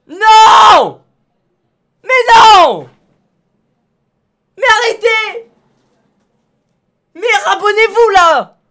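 desperate shout. Real, not acted. Streamer yelling at chat to resubscribe, desperate. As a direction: scream at chat to stop. Pleading as followers unsubscribe.

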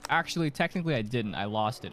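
ordinary delivery

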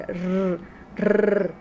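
"rrr" as a long rolled trill; sustained Spanish rolled r, isolated; letter-sound demo. Shown as a demonstration. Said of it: "es la letra que hace".

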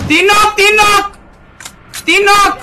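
loud excited callout; excited shout. Real, not acted. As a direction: shout the kill count out. Calling out knocks in game.

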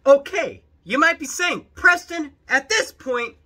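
high, very loud address; loud exclaiming. Acted. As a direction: shout over to the viewers. Loud address after screaming clip.

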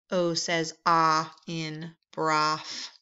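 slow phonics modeling. Shown as a demonstration. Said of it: "slow phonics explanation".

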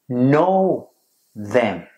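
pause, then lean hard on KNOW; stress on KNOW. Shown as a demonstration. Preacher quotes Jesus on his sheep, stress on KNOW.

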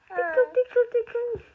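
high, loud tickle singsong; playful tickling. Real, not acted. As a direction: squeal it while tickling. Tickling the baby.

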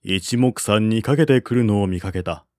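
ordinary delivery

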